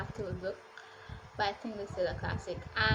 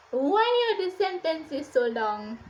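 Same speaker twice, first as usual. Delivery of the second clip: loud, higher-pitched lament; exasperated reader; 5 semitones higher, 8 dB louder than usual. Acted. Reader fed up with endless sentences, exasperated. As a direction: wail it in mock despair. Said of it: "That is how I felt while reading this book".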